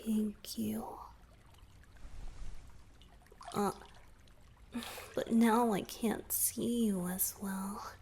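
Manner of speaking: soft breathy whisper close to the ear. Acted. Merman confiding to his savior in a whisper. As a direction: whisper it like a confession. Asks to dim the lights, whispering.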